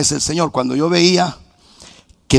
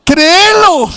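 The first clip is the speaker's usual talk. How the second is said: urging minister; 6 dB louder, much slower than usual. Retold or imitated. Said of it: "dije yo".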